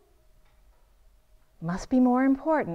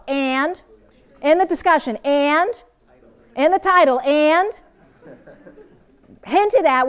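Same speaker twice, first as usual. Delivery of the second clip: higher, louder, building answers; teacher pumping up the room; rising quiz; 4 semitones higher, 8 dB louder than usual. Real, not acted. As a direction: drive the list home with each "And?". Quizzing class, answering herself.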